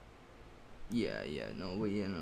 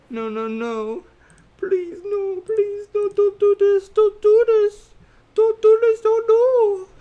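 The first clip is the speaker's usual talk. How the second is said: very high, loud, pleading; anguished denial; 14 dB louder, slower than usual. Pretend. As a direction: wail please no in mock anguish. Pleading at effects shader.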